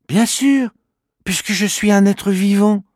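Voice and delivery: high small voice, patient; matter-of-fact little creature. Acted. Kimamila explaining he is alive, matter-of-fact creature voice. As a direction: explain it as obvious. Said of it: "répond Kimamila".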